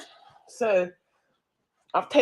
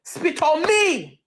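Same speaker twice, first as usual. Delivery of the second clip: slow, higher, louder outrage; slow indignant repeat; outraged disbelief; 8 semitones higher, 4 dB louder, much slower than usual. Real, not acted. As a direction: spell out the insult in disbelief. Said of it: "Are you mad?".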